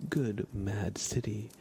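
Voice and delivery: ordinary talk, nothing marked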